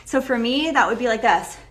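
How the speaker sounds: ordinary delivery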